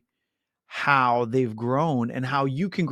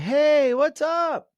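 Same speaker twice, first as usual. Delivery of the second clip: high, bright-pitched hey on the phone; mock cheerful greeting, voice up high; cheery friend; 12 semitones higher, 4 dB louder, much slower than usual. Realtor voicing client Naomi answering his call, high cheerful hello.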